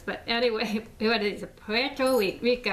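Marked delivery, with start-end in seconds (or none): 1.6-2.7 higher, halting try at a Spanish-accented PUERTO RICO; attempted Puerto Rican accent. Shown as a demonstration. Speaker trying to pronounce it as well as Trump, attempted Spanish accent. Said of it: "I can't do that accent".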